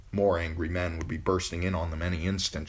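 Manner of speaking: ordinary delivery, nothing marked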